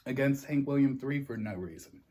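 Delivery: usual speaking voice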